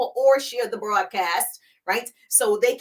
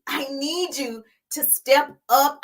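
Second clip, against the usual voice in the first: normal talking voice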